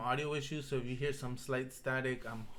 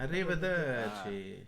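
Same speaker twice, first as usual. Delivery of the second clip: slow Italian; slower than usual. Shown as a demonstration. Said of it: "it means until we meet again".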